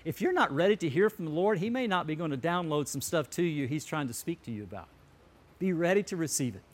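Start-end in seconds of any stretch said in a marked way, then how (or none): none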